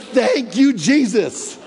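high, loud burst of thanks; relieved joy. Retold or imitated. Preacher freed from a hostile coworker, joyful loud thanks. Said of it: "And I was like".

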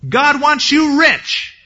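high, stretched-out prosperity pitch; mock prosperity preacher. False prophets telling the people what they love, hammed-up preaching. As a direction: draw each word out high, like a hammed-up preacher. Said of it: "the false prophets who were saying".

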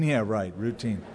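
low, quiet sceptical aside; dry disbelief. Retold or imitated. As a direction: echo the word dubiously under your breath. Sceptical thought answering pilot's reassurance.

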